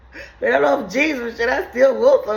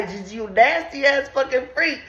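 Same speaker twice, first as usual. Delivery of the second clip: ordinary delivery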